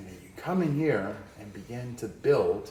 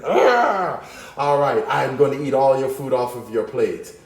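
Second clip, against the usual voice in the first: crazed villain; 3 semitones higher, 8 dB louder than usual. Acted. Teacher voices Forkman threatening to eat your food, manic and loud. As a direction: play the food-stealing maniac.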